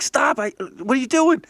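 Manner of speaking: high, loud panicked shout; frantic yell at a kicking friend. Acted. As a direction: yell at him to stop. Dan yelling at kicking.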